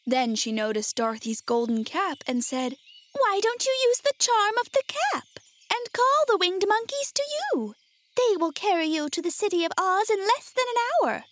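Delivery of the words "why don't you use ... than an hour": high, tiny mouse-queen voice; small squeaky storybook queen; squeaky little voice. A narrator's reading. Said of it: "in her squeaky little voice".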